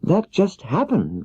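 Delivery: Dorothy simply explaining to Oz; simple Dorothy. Acted. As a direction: say it plainly, like a child. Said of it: "returned Dorothy simply".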